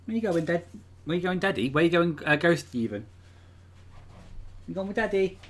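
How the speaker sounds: high cooing pet voice; doting dog talk. Real, not acted. Asking the dog.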